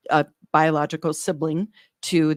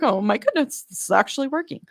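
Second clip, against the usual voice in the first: higher, fast and amazed; excited disbelief; 8 semitones higher than usual. Retold or imitated. Nurse realizing her breathing calms the baby, amazed and fast. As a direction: burst out in delighted surprise. Amazed the calming trick works.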